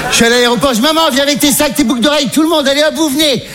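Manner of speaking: high, excited call to come along; acted jubilant summons; urgent hurrying. Hurrying mother along at airport.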